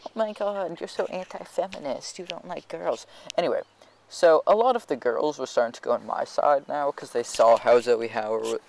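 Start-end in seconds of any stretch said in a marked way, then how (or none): 0.1-3.0 higher, softer mock girl chorus. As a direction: parody their outrage. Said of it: "started being like".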